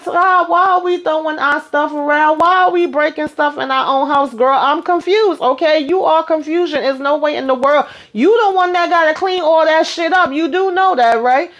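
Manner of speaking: sassy scolding of a friend; host mock-scolding Ty for trashing her own house; incredulous. Real, not acted.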